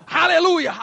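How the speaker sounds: loud joyful hallelujah; shouted hallelujah. Shown as a demonstration. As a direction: shout it out from the spirit. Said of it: "berteriak keluar dari rohmu".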